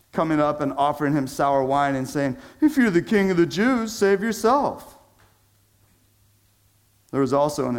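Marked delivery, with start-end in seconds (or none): 2.6-5.0 mocking. Soldiers mocking Jesus on the cross, sneering taunt. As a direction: taunt him like a jeering soldier. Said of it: "The soldiers also mocked him".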